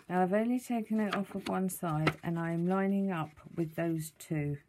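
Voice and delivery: ordinary delivery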